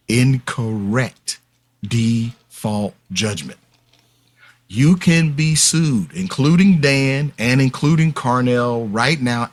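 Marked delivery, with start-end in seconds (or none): 0.1-3.6 slow and spaced out, word by word; said slowly. Shown as a demonstration. Repeating the term for listeners who found it confusing, said slowly. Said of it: "So let me say it slowly".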